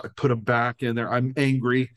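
usual speaking voice

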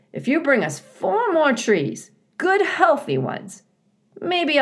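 high, eager beaver voice. A narrator's reading.

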